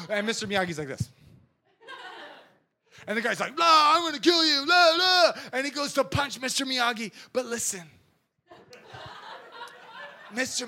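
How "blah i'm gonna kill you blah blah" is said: higher, louder thug growling a threat; tough guy threat. Retold or imitated. Big thug threatening little old Miyagi, mock tough shout. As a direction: bark it like a movie bully.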